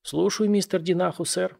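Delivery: put-on toothless old man mumbling. A narrator's reading. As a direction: mumble it through a toothless mouth. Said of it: "прошамкал старик беззубым ртом".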